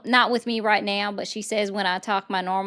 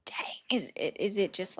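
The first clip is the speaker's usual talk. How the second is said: breathy under-the-breath "dang"; whispered aside; 6 dB quieter than usual. Real, not acted. Streamer reacts to chat under her breath, whispering. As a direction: let it slip out as a whisper.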